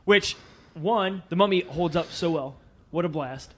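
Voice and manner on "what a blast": ordinary talk, nothing marked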